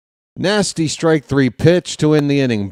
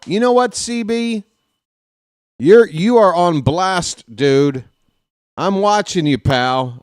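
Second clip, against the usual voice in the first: angry warning; 5 semitones higher, 4 dB louder than usual. Real, not acted. Warning the umpire.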